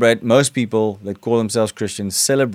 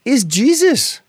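put-on wife comforting child; 12 semitones higher than usual. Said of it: "And then she said".

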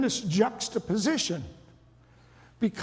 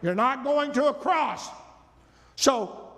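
brash rebuke; 9 semitones higher than usual. Acted. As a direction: scold your leader, all bluster. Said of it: "He starts rebuking Jesus".

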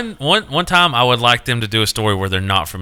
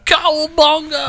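high, drawn-out surfer-style cowabunga; catchphrase cry; slower than usual. Acted. Answering a question about saying cowabunga, high drawn-out catchphrase cry. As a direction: call it out like a cartoon hero's slogan. Calls out Turtles catchphrase on cue.

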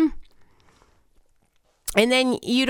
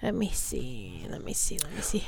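muttering to self; 8 semitones lower, 10 dB quieter than usual. Real, not acted. Host checking for the DJ's name, muttering to self. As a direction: murmur it under your breath, barely voiced. Talking to self while searching.

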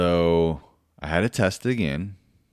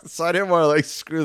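high, loud amused delivery; laughing; 12 semitones higher, 4 dB louder, faster than usual. Real, not acted. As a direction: talk on through a chuckle. Laughs while telling the story.

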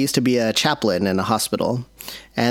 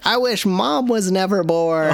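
put-on whining child; 7 semitones higher than usual. Child's tantrum voiced by dad.

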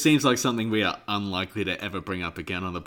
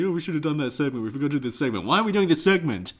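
impression of Nick; 6 semitones higher, faster than usual. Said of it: "That's such a good impression of me".

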